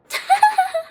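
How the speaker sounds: squeaky high laugh; high-pitched giggle. Real, not acted. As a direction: burst into a shrill little giggle. Laughing at friend's rant.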